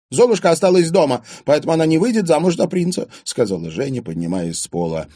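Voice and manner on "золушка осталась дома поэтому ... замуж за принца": high, loud, rapid complaint; upset outburst. A narrator's reading. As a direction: blurt it out, all worked up. Bitter, tearful complaint after being freed. (расстроенно, взахлёб)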